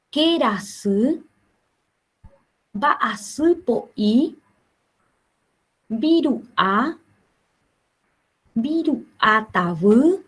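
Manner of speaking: each Guarani word said slowly, with long pauses between; deliberate classroom pronunciation drill; slow word list with pauses. Shown as a demonstration. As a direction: read the words slowly so students can repeat. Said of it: "le voy a dar así pequeñas pausas".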